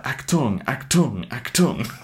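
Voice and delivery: mock German robot announcer call; German accent. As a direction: bark Achtung in a German accent. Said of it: "the German".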